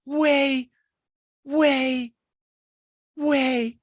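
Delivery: ordinary delivery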